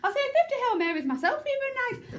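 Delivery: high, breakneck character gabble; really fast. Shown as a demonstration. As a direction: race through the confession nervously. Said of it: "really fast doing this".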